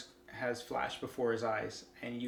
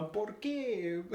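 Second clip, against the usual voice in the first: mock despairing cry in Spanish; 7 semitones higher than usual. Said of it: "he breaks into Spanish".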